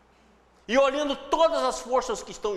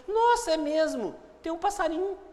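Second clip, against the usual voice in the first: amazed discovery; 6 semitones higher than usual. Acted. Listener finally notices the bird in the noise, amazed.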